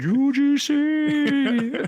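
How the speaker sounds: very high, drawn out and airy; ethereal. Shown as a demonstration. Host demonstrating how to say the sponsor's initials, very high and very slow. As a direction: float it up into the background like a jingle. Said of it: "has to be ethereal like that".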